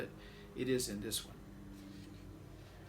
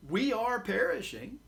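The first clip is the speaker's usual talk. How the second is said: exclaiming; 8 semitones higher than usual. Acted. Disciples exclaim to Jesus in the storm, alarmed cry. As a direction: call it out as sudden news. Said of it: "they exclaim".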